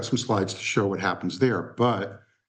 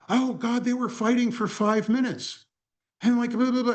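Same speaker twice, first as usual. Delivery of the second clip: much higher, shocked rush; upset gushing; 13 semitones higher than usual. Retold or imitated. Assistant describing the fight, very high and shocked. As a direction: pitch it way up in disbelief. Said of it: "comes back to the office and is tears".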